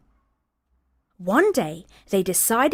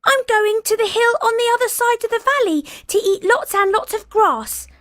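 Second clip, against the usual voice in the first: much higher, louder tiny goat voice; small voice; 14 semitones higher, 5 dB louder than usual. Acted. Littlest goat telling the troll where he goes, tiny and high. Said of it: "with such a small voice".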